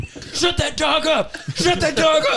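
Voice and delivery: raid cop shouting orders. Pretend. Acted police raid.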